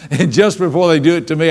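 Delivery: usual speaking voice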